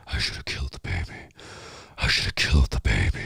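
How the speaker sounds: low, whispered killer voice; Billy's voice. Retold or imitated. Actor voicing Billy on the phone, low whispered killer. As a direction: rasp it low and breathless like a killer. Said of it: "you hear Billy".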